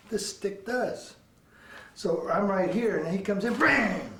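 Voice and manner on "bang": high bang, falling away; drawn vocal hit noise; imitated strike. Shown as a demonstration. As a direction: ring out the stick's impact.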